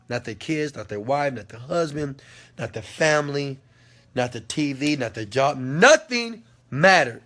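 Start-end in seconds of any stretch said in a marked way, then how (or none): 5.6-7.2 emphatic shout. Real, not acted. Preaching total focus.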